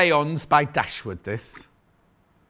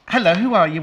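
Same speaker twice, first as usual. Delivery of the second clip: ordinary talk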